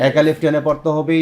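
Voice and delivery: usual speaking voice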